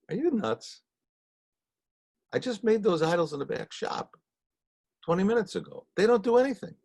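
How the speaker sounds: an exasperated father's rebuke; incredulous father. Retold or imitated. Father berating his son.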